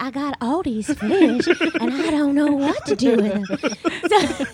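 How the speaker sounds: slow, airy 1920s starlet voice; breathy hollywood starlet. Pretend. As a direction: purr it like an old film star. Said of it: "nineteen twenties Hollywood starlet".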